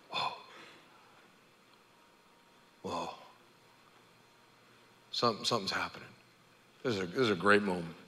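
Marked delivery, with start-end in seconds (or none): 0.1-6.1 soft, amazed whisper; awed hush. Retold or imitated. Leper feeling his body heal, awestruck hush. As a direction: breathe it out in wonder.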